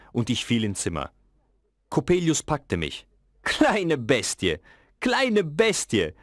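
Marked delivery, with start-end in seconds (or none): 3.4-6.1 slow, high, mocking villain voice; slow sneering taunt at a child; gloating villain. A narrator's reading. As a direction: savour each word like a cruel old man. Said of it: "sagte er lachend".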